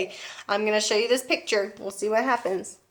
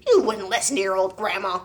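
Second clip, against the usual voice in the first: ordinary delivery, nothing marked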